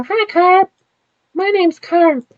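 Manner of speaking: put-on very high squeaky kid voice; little boy voice. Boy Carp introducing himself, very high. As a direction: pitch way up like a small boy.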